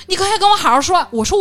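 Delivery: high, loud imitation of an opponent; mock indignant. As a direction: demand it loudly, mimicking an affronted arguer. Acted quarrel, opponent's demand.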